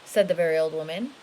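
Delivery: lively storybook read, rising and falling; storybook voice for a little one; reading to children. A narrator's reading. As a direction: read it big and animated for a child.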